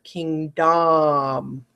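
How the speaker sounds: king dom split in two clear syllables; spelling pronunciation. Shown as a demonstration. Teacher splitting kingdom for spellers. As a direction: say king, pause, then dom. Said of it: "I want you to say to spell king".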